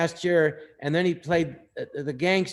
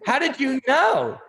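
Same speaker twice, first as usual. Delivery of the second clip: excited; 11 semitones higher, 5 dB louder, slower than usual. Retold or imitated. Excited reply to Robert.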